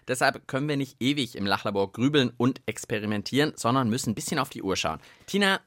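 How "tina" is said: ordinary talk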